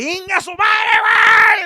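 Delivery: high, loud whooping yell; dad screaming on Space Mountain; wild shout. Retold or imitated. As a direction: holler it with full thrill. Dad yelling on ride.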